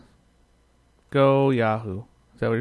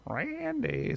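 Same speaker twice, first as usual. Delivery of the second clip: high called name; 11 semitones higher, 4 dB quieter than usual. Retold or imitated. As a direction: call out a name, high and drawn. Imitating someone outside yelling.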